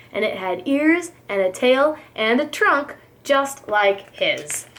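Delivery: plain reading voice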